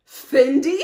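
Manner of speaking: loud rising question on "Fendi"; Sutton. Retold or imitated. Sutton still not getting the name, incredulous "Fendi". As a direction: ask "Fendi" as if utterly baffled. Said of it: "And Sutton".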